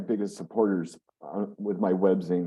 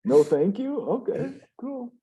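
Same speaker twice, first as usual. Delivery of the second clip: high incredulous laugh; surprised; 11 semitones higher, 3 dB louder, much slower than usual. Real, not acted. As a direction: feign disbelief, pitch up. Mock disappointment at short answer.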